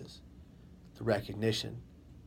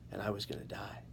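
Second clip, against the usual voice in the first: whispering; 2 semitones lower, 7 dB quieter than usual. Retold or imitated. Fatal mistake realized in fall.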